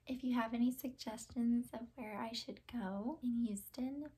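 soft breathy whisper to camera. Real, not acted. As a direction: whisper the request. Outro, unvoiced.

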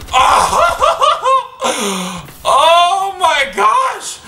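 high laughing outburst; laughing shout. Real, not acted. Laughing shock at a kill.

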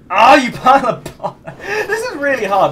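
loud laughing exasperation; laughing frustration. Real, not acted. Laughs while failing the level.

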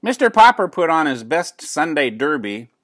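ordinary delivery, nothing marked

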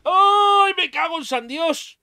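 high, loud startled yelp then curse; real jump-scare shriek into swearing; startled scream. Real, not acted. (grito de susto real)

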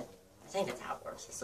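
unvoiced quick aside to himself; whispered aside. Real, not acted. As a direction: mutter it under your breath, just air. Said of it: "unvoiced aside".

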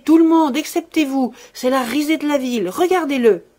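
loud, high-pitched taunting tirade; furious yelping. A narrator's reading. Servant fleeing round the table from her master, yelping furiously. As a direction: yelp it out in full fury. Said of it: "elle glapissait".

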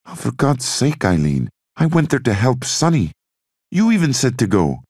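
exasperated outburst. Acted. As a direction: throw it back at her, exasperated. Bobby snaps back at sister.

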